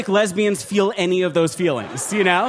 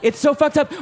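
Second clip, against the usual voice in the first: ordinary talk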